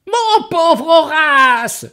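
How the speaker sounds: very high, slow, loud wail; ogress crying out. Acted. Ogress finding Horace on the floor, high slow cry. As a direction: cry out in dismay, drawn out. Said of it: "s'écrie l'ogresse".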